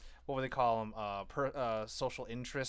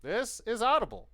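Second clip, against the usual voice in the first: higher, louder mock old man; old man announcer; 11 semitones higher, 5 dB louder than usual. Host mimics the audiobook intro, mock old-man announcer. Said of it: "That old man. Liz?".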